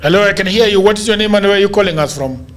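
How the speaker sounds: usual speaking voice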